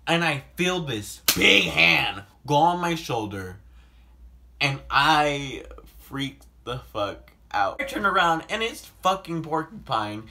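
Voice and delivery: usual speaking voice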